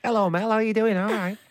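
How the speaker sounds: high, squeaky northern critter; northern squirrel voice. Pretend. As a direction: chirp it like a tiny northern squirrel. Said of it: "David Suchet of the squirrel characterisation workshop".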